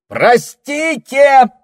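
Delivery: very loud high slow yell; full shout. Acted. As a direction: shout with all your might. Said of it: "что есть мочи, прокричал Саймон".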